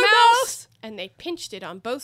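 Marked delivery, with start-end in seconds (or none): 0.0-0.7 shouting. A narrator's reading. Shouting to wake Dormouse.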